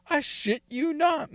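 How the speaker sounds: I swear, high and insistent; emphatic vow. Real, not acted. To his listener, insisting the wild story is true, pitched high.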